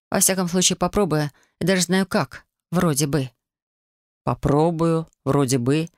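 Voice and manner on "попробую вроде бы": measured, lowering echo of doubtful words; deliberate, lightly voiced male echo; slow repetition. A narrator's reading. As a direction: repeat it slowly, dropping low at the end. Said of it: "медленно повторил Гейл".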